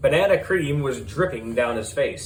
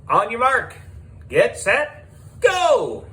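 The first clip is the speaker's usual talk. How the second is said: starting call; 9 semitones higher, slower than usual. Acted. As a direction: call the start like a race marshal. Host starting the race.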